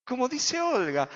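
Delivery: normal talking voice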